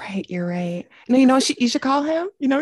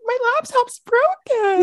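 high, slow whiny quote; whiny panic; 13 semitones higher than usual. Retold or imitated. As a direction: whine it like a small crisis. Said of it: "I was like".

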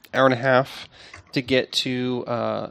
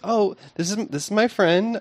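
higher, eager introduction; a mildly higher, eager introduction; introducing a friend; 7 semitones higher, 3 dB louder than usual. Acted. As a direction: introduce your friend a little too brightly. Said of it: "And she goes".

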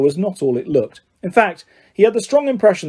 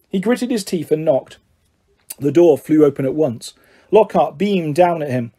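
usual speaking voice

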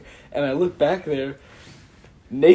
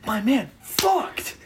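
triumphant thought; 7 semitones higher, much slower than usual. Retold or imitated. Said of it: "thinking in my head".

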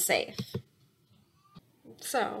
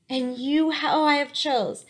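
higher and slow, voice welling up as she remembers; choked up with emotion; 5 semitones higher, slower than usual. Real, not acted. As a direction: let the feeling catch in the throat, slowing down. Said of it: "it makes me really emotional".

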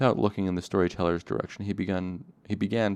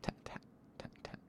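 whispered tat tat tapping; breathy drip noises; tapping drip sound; 17 dB quieter than usual. A narrator's reading. Dripping liquor sound effect.

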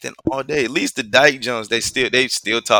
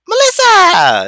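high, loud, drawn-out shriek of a name, slow; mock flamboyant call; 12 dB louder, slower than usual. Mocking a dramatic guy calling out to a friend. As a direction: ham it up calling across the room. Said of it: "they be like".

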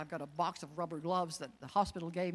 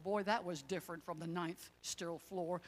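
ordinary talk

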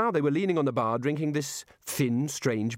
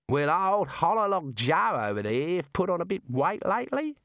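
Dongo's Aussie voice; 7 semitones higher than usual. Acted. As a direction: talk like an outback barman. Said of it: "said Dongo".

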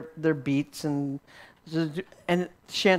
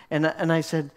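normal talking voice

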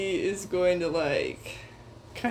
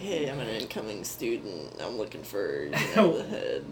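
low mock student voice; 10 semitones lower, 4 dB quieter than usual. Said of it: "I can be like".

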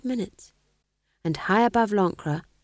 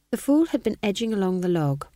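plain reading voice

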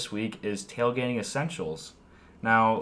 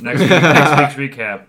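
loud, higher-pitched playful announcement; mock teaser; 4 semitones higher, 14 dB louder than usual. Host teasing friends about next week's recap, loud mock teaser. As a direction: call it out like a joke preview.

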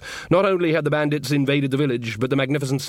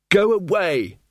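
sulky bride; 7 semitones higher, slower than usual. Acted. Magrat shouting through locked door.

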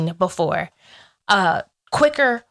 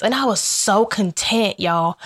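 ordinary talk, nothing marked

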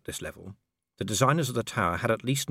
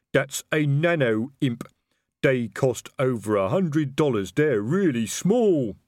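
louder, slow, heavy troll voice; 5 semitones higher, 5 dB louder, slower than usual. A narrator's reading. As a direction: speak like a slow-witted troll. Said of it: "said detritus".

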